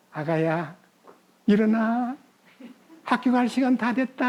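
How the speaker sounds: gentle, lilting wake-up call; soft coaxing mother. Retold or imitated. Mother gently waking her child for school, soft and coaxing. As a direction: coax a sleeping child, drawing the endings out. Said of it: "이제 부드럽게 싹 가가지고".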